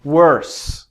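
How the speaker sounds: teacher modelling "Worse" as /wə˞s/; clear model word. Shown as a demonstration. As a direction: say "Worse" slowly and clearly for the class. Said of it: "I'm gonna say a word".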